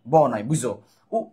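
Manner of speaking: ordinary talk, nothing marked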